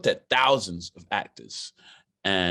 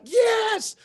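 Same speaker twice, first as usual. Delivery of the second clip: much higher, shouted cheer; excited shout; 4 dB louder than usual. Retold or imitated. Actor jumping into the pool, shouting for joy.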